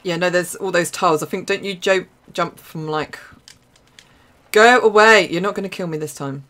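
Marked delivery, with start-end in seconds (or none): 4.5-5.3 loud, higher shout at the enemy. Real, not acted. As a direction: yell to drive it off. Enemy attacking her.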